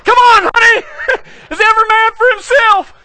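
high, loud call through laughter; laughing call. Real, not acted. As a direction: shout after her while cracking up. Left behind, laughing.

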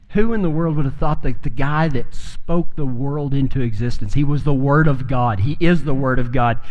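ordinary delivery

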